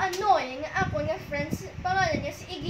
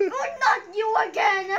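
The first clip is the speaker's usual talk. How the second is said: exasperated; 4 semitones higher, 7 dB louder than usual. Retold or imitated. Acting out game annoyance.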